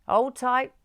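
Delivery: call out. A narrator's reading. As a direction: shout a warning to hold on. Driver warns before U-turn.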